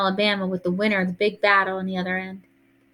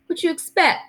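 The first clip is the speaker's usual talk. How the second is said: lightly voiced indignant kid; 10 semitones higher than usual. Voices Byron talking back.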